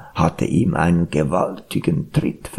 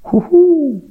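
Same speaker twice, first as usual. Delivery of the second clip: high, loud owl hoot; long, swooping owl call; 5 dB louder than usual. A narrator's reading. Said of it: "heulte die Eule".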